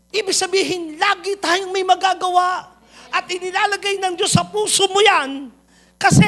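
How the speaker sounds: high, loud pulpit climax; climactic preaching. Acted. Pastor telling the church God puts it in their heart, ringing and loud. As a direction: lift it to a ringing peak.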